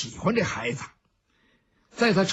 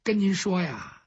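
ordinary delivery

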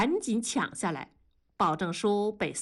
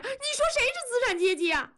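even shriller, very high wounded cry; escalating, near tears; faster than usual. Acted. Wife repeats challenge to husband, very high tearful outburst. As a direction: push the question up to a peak of hurt. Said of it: "文丽要哭了".

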